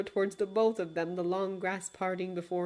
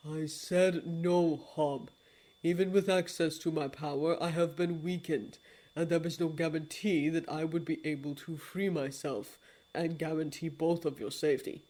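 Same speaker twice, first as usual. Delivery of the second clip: a low, hushed apology; grave lord of dreams; 3 semitones lower than usual. Acted. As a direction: speak like a deep and remorseful old god.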